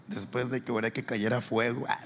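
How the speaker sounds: ordinary talk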